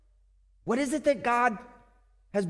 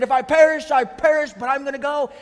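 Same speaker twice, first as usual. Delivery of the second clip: loud, higher defiant vow; bold defiance; 4 semitones higher, 5 dB louder than usual. Retold or imitated. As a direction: say it like a bold statement. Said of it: "that's how she said it".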